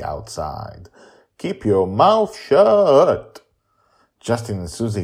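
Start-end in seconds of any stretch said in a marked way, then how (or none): 1.4-3.4 high, loud, slow warning; loud drawn-out grandma warning; stern warning. Acted. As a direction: spell it out as a threat. Susie warns Justin.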